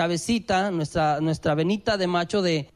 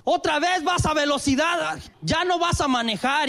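scolding shout; 7 semitones higher than usual. Retold or imitated. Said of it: "otra vez me pasa".

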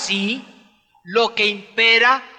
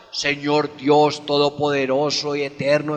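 mock pompous prayer; 6 semitones lower than usual. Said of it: "le hablamos al Señor de muchas palabras".

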